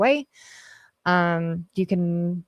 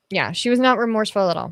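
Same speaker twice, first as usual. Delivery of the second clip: ordinary talk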